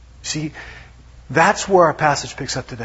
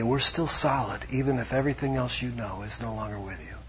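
gentle voice of God; 3 semitones lower, 8 dB quieter than usual. Retold or imitated. God's voice, soft.

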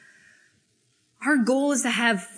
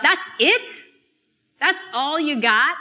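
mock yell; 5 semitones higher than usual. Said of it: "it yells out".

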